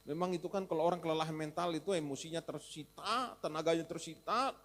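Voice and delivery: normal talking voice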